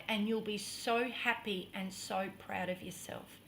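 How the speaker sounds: soft, patient reassurance; gentle coaxing. Retold or imitated. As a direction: soothe a frightened partner, softly. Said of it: "so gentle and so patient with me".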